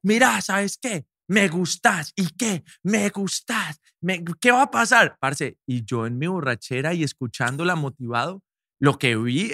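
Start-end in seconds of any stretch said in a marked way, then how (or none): 0.0-5.2 mock aggressive. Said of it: "Ella me lo dice agresiva".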